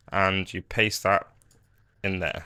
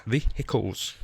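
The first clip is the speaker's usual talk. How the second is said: mock American; 5 dB quieter, slower than usual. Tutorial maker taking the piss out of Americans, mock American accent. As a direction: softly repeat "Vehicles" with a hard American h. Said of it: "taking the piss out of you Americans".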